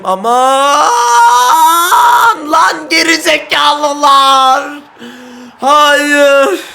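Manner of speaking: very high, loud furious yelling; raging shout. Real, not acted. Gamer screaming at his team as the goal goes in. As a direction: explode in anger.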